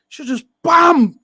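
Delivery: slow, loud exclamation on bam; real shout. Real, not acted. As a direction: punch out the bam. Replaying the missed header.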